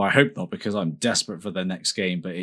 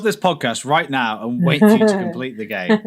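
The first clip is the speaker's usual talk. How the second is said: loud mock outrage, high and loud; mock indignant; 8 semitones higher, 5 dB louder than usual. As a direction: pretend to halt the show, loudly. Mock stopping the podcast.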